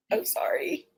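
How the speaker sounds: very soft whispered apology; overcome whisper. Real, not acted. Apologizing to viewers after shocking news, whispered. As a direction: barely get it out, all breath.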